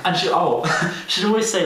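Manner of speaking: ordinary delivery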